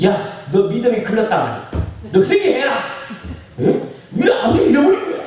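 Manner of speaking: high, rising mock rebuke with a laugh; scolding father. Father rebuking his daughter over the phone, mock scolding. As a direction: playfully imitate a stern father's telling-off. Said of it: "아버지가 그래요".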